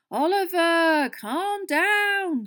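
high, very loud, drawn-out appeal; alarmed shout. A narrator's reading. Fred trying to calm the captain, alarmed shout. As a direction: call over his ranting to stop him. Teammate calming a ranting captain.

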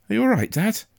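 put-on high-pitched boy's voice, concerned; worried young boy. A narrator's reading. Boy checking on his injured father, worried. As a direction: ask as an anxious child.

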